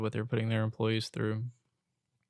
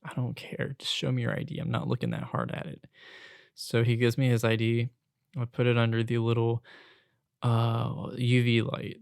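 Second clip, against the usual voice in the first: ordinary talk, nothing marked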